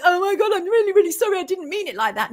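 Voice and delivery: high, mocking apologetic voice; mock a gushing apology, pitched well up; hammed-up grovelling, starting very high and easing down; Dawn French. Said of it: "And she's like".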